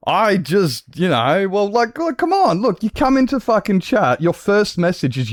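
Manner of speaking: high-pitched exasperated protest; worked-up complaint to a rude commenter. Real, not acted. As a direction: argue back in disbelief, voice pushed high.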